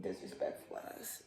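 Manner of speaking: breathless unvoiced whisper, slow; whispered aside. Real, not acted. A slow whispered aside about the disrespectful pet. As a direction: hiss it under your breath.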